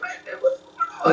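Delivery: high, laughing alarm; retelling the riot with laughing high surprise; mock shock. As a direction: pitch way up in laughing disbelief. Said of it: "I was like".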